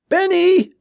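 very high, alarmed cry; panicky shout. A narrator's reading. Tommy calling his brother Ben, panicky shout. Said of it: "his voice even higher and panicky".

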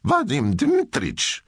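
high, drawn-out excited call; an eager boy appealing to his teacher; worked up. A narrator's reading. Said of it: "Der Junge ereiferte sich".

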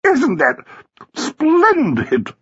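high, gushing delight; exult, lingering on splendid; squealing society-woman triumph; triumphant. A narrator's reading. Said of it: "said Flossie in triumph".

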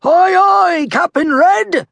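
high, loud crew response; merry pirate crew. A narrator's reading. Merry crew answering their captain, high loud shout. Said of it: "the merry crew replied".

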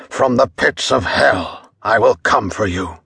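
lightly voiced vengeful burning man; vengeful curse. A narrator's reading. Copper cursing the mob from the stake, lightly voiced menace. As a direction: threaten them from beyond, a hint of menace.

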